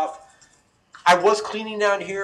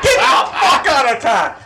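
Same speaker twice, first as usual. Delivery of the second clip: very loud, high-pitched outburst; exasperated mock shout; 8 semitones higher, 13 dB louder, slower than usual. Back in bed when noise returns, exasperated yell. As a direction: bellow it in comic disbelief.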